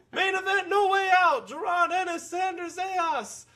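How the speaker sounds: hammed-up ringside call to King; Jim Ross. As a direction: yell it like a wrestling commentator. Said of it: "and Jim Ross is going".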